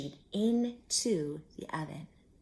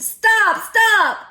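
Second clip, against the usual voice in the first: much higher, loud frantic scream; screaming stop; 15 dB louder than usual. A narrator's reading. Old woman screams at the fleeing cookie, frantic and loud. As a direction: scream after the runaway cookie. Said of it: "The little old woman screamed".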